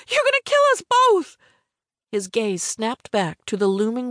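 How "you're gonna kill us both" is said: very high, panicked shout. A narrator's reading. Passenger about to crash, shouting in panic. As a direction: cry out to stop him, pitch soaring. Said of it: "I shouted".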